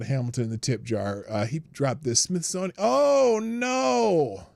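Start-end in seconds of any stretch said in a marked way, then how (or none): 2.8-4.5 high, drawn-out cry of dismay; dismayed groan. Real, not acted. Dismayed reaction to Smithsonian headline.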